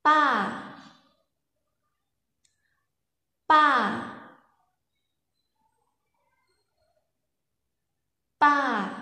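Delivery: "Pa" high, level and strong; Lao mai ek tone. Shown as a demonstration. Teacher models the Lao high tone for students. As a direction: say "Pa" high and flat with force. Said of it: "các bạn phát âm theo cô nhá".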